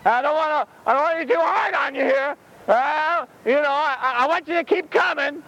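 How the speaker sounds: high, mealy-mouthed dithering; namby-pamby. Acted. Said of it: "it wasn't mamby-pamby".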